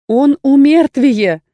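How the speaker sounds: loud, slow and distinct; blurted out. A narrator's reading. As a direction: blurt it out clearly. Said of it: "брякнула я неожиданно чётко". (выпалить неожиданно чётко)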